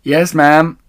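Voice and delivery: loud, high obedient reply; calling up. Acted. Mabel answers Missus Bean, loud and high. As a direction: answer up dutifully. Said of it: "Mabel said".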